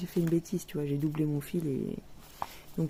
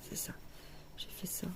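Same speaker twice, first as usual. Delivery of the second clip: whispered; 11 dB quieter than usual. Real, not acted. Murmuring while pointing at stitches.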